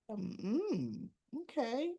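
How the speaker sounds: coy, drawn-out hum and a soft okay. Retold or imitated. Woman answering his flirty property pitch, coy. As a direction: play it hesitant, softly lifting the okay.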